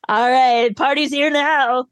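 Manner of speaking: lightly hammed-up entrance; hyped party crasher. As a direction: announce your arrival, stretching it out. Acts out Jax clapping, crashing party.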